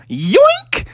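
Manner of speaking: very high, rising yelp; gleeful snatch. Acted. Junior Bear snatching lava shoes, high gleeful yelp. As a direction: squeak it while grabbing something.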